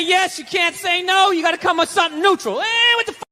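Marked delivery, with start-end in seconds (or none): 2.6-3.2 mock shrugging. Neutral shrug reply to wife.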